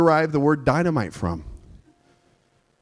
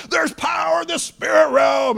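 fervent preaching shout; 11 semitones higher than usual. Retold or imitated. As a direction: preach it oblivious to the chaos. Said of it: "I'm just like".

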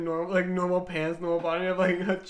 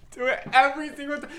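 ordinary talk

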